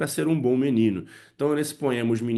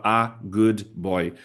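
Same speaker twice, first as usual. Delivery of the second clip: put-on A, GOOD, BOY as separate slow words; unlinked, very slow "a good boy"; disconnected words; 2 semitones lower, much slower than usual. Shown as a demonstration. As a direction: say "a good boy" word by word with gaps. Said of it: "ele não vai falar".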